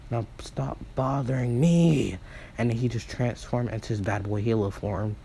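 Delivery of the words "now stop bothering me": slow, drawn-out annoyed outburst; exasperated. Acted. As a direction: push them away, fed up. Izuku rejects his pleading siblings.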